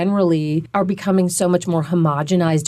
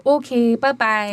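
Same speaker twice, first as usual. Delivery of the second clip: higher "Okay", long Minnesota o; Minnesota sign-off; 3 semitones higher than usual. Retold or imitated. Linguist imitating caller Stacy, Minnesota accent. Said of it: "I love her".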